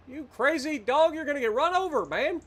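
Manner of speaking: exasperated yell at the careless dog; scolding yell. Real, not acted. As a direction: scold the dog at the top of your voice. Yelling at dog in road.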